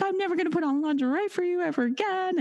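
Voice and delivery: high, fast mock-sobbing protest; imitating crying wail. Rejected girlfriend complaining to her boyfriend, mock crying wail. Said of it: "she starts crying".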